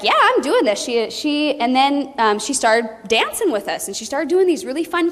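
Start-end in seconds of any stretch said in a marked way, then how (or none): 0.0-0.8 high, bright eager agreement; high, louder excited recruit; eager yes. Retold or imitated. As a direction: jump right in, thrilled. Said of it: "And she's like".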